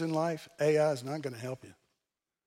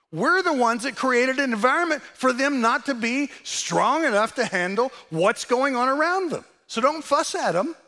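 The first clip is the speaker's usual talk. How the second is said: fervent raised preaching voice; 8 semitones higher, 5 dB louder than usual. Real, not acted. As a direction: preach it with force. Preaching at full force.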